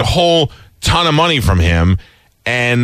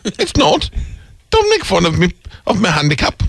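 high, put-on lady voice; prim lady taking offence; English lady voice; 6 semitones higher than usual. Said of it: "Lady English lady".

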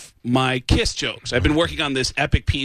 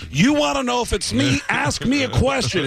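high and pushy, daring her to test him; cocky rock star; 11 semitones higher than usual. Pretend. Caller posing as a rock star, daring the assistant to test him. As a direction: throw the challenge back like a star.

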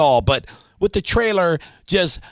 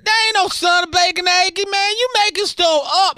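excited neighbor yell; 10 semitones higher than usual. Acted. Host yells to his neighbor Ryan about the bacon smell. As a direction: call out to Ryan, thrilled by the smell.

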